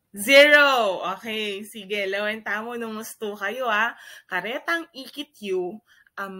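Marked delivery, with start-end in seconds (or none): 0.1-1.0 countdown shout. Real, not acted. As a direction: call out "zero" loud and higher.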